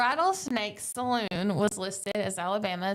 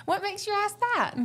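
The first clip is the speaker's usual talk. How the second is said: high mock genteel voice; 11 semitones higher than usual. Mock genteel Southern reply.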